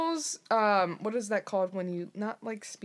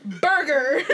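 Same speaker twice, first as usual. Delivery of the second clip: high, loud mock exclamation; loud joking outburst; 5 semitones higher, 9 dB louder than usual. Joking with friends about baptizing in ketchup, loud outburst. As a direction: blurt it out loudly for a laugh. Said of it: "I'm just like".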